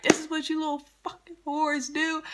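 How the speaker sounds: high, mocking imitation of a furious mother; imitating angry mom. Boyfriend's mom catching them, mock high angry yell. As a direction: shriek it high like the outraged mom. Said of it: "She's like".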